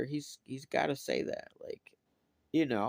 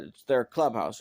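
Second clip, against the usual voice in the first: ordinary talk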